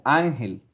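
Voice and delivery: "ángel" with a drawn-out ÁN, then falling; stress on first syllable. Shown as a demonstration. Teacher repeating the tonic-A example, first-syllable stress. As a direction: stretch and stress the opening of "ángel". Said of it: "Ángel es tónica".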